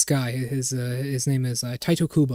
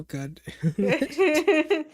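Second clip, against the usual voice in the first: laughing mock whine; much slower than usual. Mock Valley Girl complaint.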